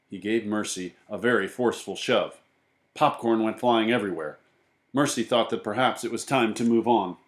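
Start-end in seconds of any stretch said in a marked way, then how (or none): none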